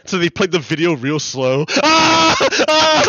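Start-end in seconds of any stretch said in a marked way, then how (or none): 1.8-3.0 laughing shriek. Real, not acted.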